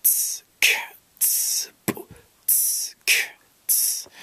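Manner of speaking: whispered ts and k clicks; unvoiced ts, k, ts beatbox hits; beatbox sounds. Shown as a demonstration. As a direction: spit out the hi-hat and snare. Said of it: "all you're saying is b".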